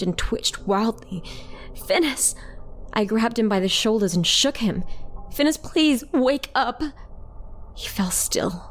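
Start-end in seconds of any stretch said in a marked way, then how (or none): 1.7-2.3 alarmed call. A narrator's reading. Calls to twitching sibling. 5.3-6.8 high-pitched anxious plea; urgent pleading. A narrator's reading. Pleads with sibling to wake.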